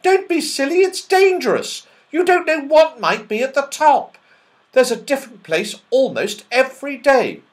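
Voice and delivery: high, loud, alarmed elf; horrified warning. Acted. Elf warning the children of danger, high loud and alarmed. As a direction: warn them off in alarm. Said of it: "in horror".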